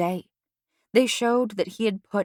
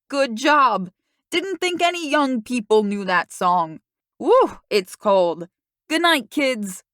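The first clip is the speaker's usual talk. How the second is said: put-on higher, louder, cheerful older neighbor with a slow shivering "Woo"; cheery neighbor lady; 5 semitones higher, 6 dB louder, slower than usual. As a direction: call out to the kids kindly from the porch. Neighbor voiced in the story.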